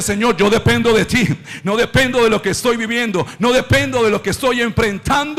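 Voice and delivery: loud, driving declaration of trust; impassioned preaching. Acted. Preacher voicing a believer's declaration to God, impassioned. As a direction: declare each line with rising force.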